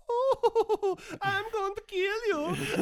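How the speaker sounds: high, slow, laughing villain voice; sinister jester voice. Retold or imitated. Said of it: "A little bit like".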